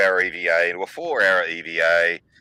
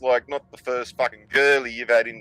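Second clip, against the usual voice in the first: ordinary talk, nothing marked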